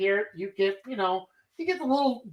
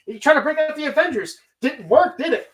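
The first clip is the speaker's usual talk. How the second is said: higher, fast mocking taunt; taunting agent; 7 semitones higher, 4 dB louder than usual. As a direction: rattle it off high, like a smug agent jabbing Zemo. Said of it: "Agent Freeman walks in and was just like".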